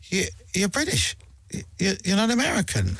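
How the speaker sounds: lightly voiced surprised American; a hint of an amazed American fan. Host imitates Americans amazed he is British, lightly voiced. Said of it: "people who go".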